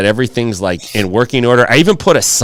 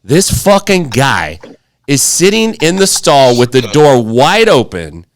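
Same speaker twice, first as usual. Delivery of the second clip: ordinary delivery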